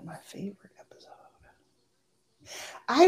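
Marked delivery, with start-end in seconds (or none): 0.1-1.1 whispered to herself; whispering. Real, not acted. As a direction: breathe it out while thinking. Thinking to herself.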